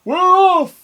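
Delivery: very high, loud cheer; rowdy crew cheer; crew shout. A narrator's reading. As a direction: shout it together like a pirate crew. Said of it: "we all shouted".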